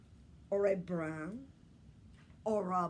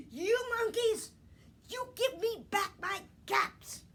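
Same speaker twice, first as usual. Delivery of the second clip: much higher, louder angry demand; angry peddler; 12 semitones higher, 8 dB louder than usual. Acted. Peddler angrily demanding his caps from the monkeys. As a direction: shake both fists in your voice. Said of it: "this made the peddler angry".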